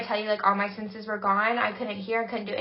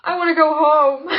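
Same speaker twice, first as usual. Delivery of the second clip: loud, whiny plea to leave; fed up; 5 semitones higher, 11 dB louder than usual. Retold or imitated. Drunk friend begging Emily to leave, loud and whiny. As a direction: beg to leave, loud and done. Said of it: "I looked at Emily and I said".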